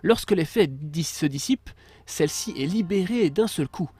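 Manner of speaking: slow, grand announcer read; dramatic reading. Acted. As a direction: announce it like a game trailer. Reads power description aloud.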